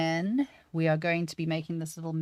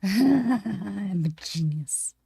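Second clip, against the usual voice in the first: slow, louder self-congratulation after a laugh; laugh, then draw out the boast; gloating laugh; 3 semitones higher, 6 dB louder, much slower than usual. Real, not acted. Streamer celebrates her trick working, gloating laugh.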